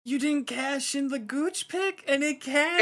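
gasp, then high shocked disbelief. Real, not acted. Shocked at missed pick.

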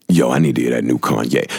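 low, casual homie voice; friend's real ask. Retold or imitated. Quoting what the friend actually said, low and casual. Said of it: "But he's saying".

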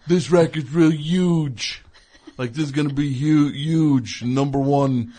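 imitating Trump, slow and boastful; Trump impression. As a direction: brag like Trump, drawn out. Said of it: "where he'll sing, like".

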